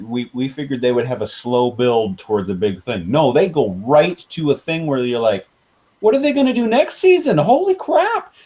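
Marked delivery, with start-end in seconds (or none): 6.0-8.3 high, fast, hammed-up amazement; amazed viewer. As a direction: act out a viewer being blown away. Acting out amazed viewer reaction.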